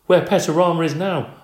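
squeaky. Acted. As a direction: blurt it out in a squeak. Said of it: "I squeaked".

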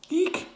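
very high startled little squeak; hamster squeak. Acted. Hamster Humphrey blurting out in surprise, a very high squeak. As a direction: squeak it out in a small startled burst. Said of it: "I squeaked".

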